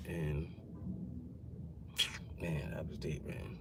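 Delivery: a breathless whisper, words barely there; grief-struck whisper. Real, not acted. To viewers, remembering his late friend: real whisper. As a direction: it hurts too much to say it aloud. Grieving a friend who died.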